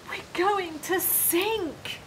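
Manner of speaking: high, loud and slow, terrified; frightened cry. A narrator's reading. Disciples in the storm crying out in fear. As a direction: cry out that the boat will sink. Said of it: "they cried".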